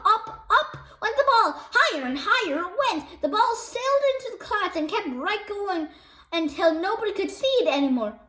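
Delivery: ordinary delivery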